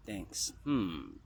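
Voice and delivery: ordinary delivery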